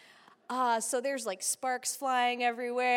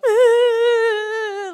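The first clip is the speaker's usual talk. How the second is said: loud high crying whine; moping whine; 12 semitones higher, 12 dB louder than usual. Retold or imitated. Grounded kid moping into the house, loud whiny wail. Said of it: "so I'm like".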